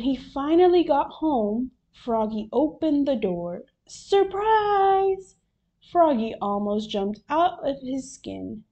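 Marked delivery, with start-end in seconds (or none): 3.9-5.4 high, drawn-out cheer; gleeful raised group-style shout; party surprise shout. A narrator's reading. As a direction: yell it like guests jumping out. Party guests yell surprise.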